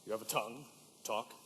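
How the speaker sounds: low, soft, weary order; grudging permission. Acted. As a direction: give in grudgingly, under the voice. Father grudgingly lets tailor talk.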